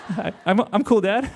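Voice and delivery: giggling while telling; laughing through it. Real, not acted. Teen answering his dad, laughing through the words.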